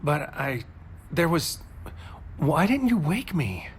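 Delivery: high, halting, tripping over the words; stammered confusion; flustered disbelief. Acted. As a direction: caught off guard and hurt. Distressed lover stumbling over words.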